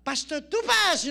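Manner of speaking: slow, loud, high call of the name; hammed-up introduction; loud announcement. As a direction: announce him to the crowd. Friend announces him as speaker.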